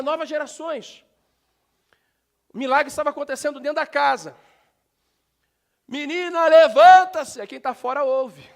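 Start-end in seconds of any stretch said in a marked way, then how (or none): 5.9-7.4 high and very loud call; a booming order to get up; shouted command. Acted. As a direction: shout her awake with full force. Jesus commanding dead girl rise.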